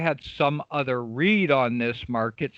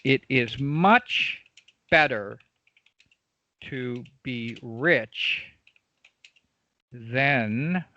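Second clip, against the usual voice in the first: very slow, word by word, with long pauses; spaced-out dictation pace; writing while speaking; much slower than usual. Real, not acted. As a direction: dictate it slowly as you write it out. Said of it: "I'm gonna write something out here".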